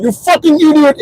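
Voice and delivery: high-pitched mock frustration; frustrated outburst. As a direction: snap like an exasperated caller. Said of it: "then they get frustrated".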